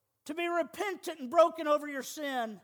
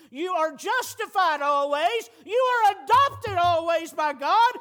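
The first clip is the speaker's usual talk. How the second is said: louder and higher with each line, rising to a ringing preach; full-force preaching; 5 semitones higher, 9 dB louder than usual. Acted. Preacher to his church, building to a loud full-force preach.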